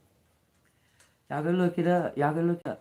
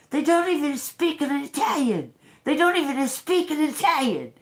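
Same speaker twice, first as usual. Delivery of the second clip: high-pitched, hammed-up outrage; mock angry man; 13 semitones higher, 5 dB louder than usual. Retelling the man walking through Rome, mock mad. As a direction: imitate his indignant rant, high and loud. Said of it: "He's mad. He's real mad".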